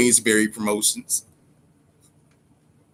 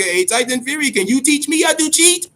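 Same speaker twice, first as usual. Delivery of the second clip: mock pleading question; 11 semitones higher than usual. Asking Fury a mocking question.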